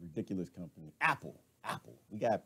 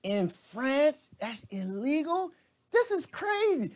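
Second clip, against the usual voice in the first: much higher, loud incredulous outburst; shocked disbelief; 3 dB louder, slower than usual. Real, not acted. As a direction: react like you can't believe it. Reacting to news clip.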